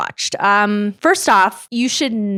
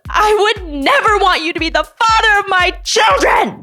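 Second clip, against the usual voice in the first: much higher, louder hammed-up shout; raised mock yell; 9 semitones higher, 3 dB louder than usual. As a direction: belt it like a dramatic parting line. Her go-to line, retold.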